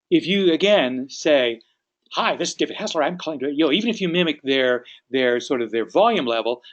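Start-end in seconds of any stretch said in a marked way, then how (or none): 2.1-3.5 mismatched quick pace. Shown as a demonstration. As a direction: hurry through the introduction. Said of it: "but your pace is different".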